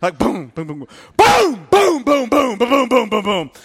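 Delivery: loud booming dish clatter; banging dishes. Retold or imitated. Martha slamming dishes in the kitchen, loud booms. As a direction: bang it out like slammed pots. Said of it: "the dishes ... got louder".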